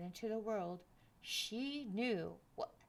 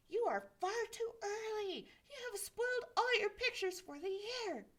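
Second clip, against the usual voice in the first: put-on 1 scolding old man; 12 semitones higher, 4 dB louder than usual. Father scolds Jack Frost.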